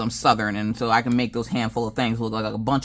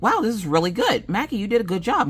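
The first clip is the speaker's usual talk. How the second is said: high, impressed family reaction; imitated praise; 9 semitones higher than usual. Family compliments the young cook, imitated enthusiasm. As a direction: gush with praise, much higher.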